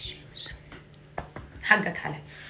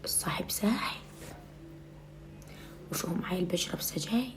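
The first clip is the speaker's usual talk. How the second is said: conspiratorial whisper; hushed warning to her son; 4 semitones lower, 20 dB quieter than usual. Acted. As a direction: whisper it like a secret. Mother lowers voice, gossip tone.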